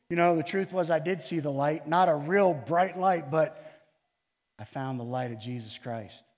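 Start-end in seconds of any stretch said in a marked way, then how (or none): none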